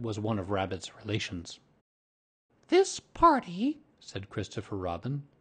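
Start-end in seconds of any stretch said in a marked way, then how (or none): 2.7-3.8 put-on high, loud, slow boyish voice; boy's speech. A narrator's reading. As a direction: announce it like a child addressing a party. Boy making a speech.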